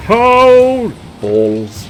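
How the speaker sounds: high, drawn-out oh; loud dismayed cry. Real, not acted. Player reacting to his worst move, loud high cry. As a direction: cry out as the move goes wrong.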